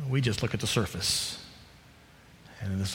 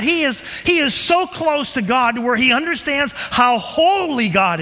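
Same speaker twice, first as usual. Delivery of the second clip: loud, high, pleading sermon voice; preaching at full force; 14 semitones higher, 7 dB louder than usual. Acted. Preacher pressing the congregation, loud and high.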